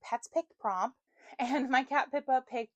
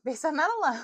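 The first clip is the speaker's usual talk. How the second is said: higher, louder restart of the line; louder retake; 7 semitones higher, 7 dB louder than usual. Shown as a demonstration. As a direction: say it again louder for the mic. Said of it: "I'm gonna move my phone".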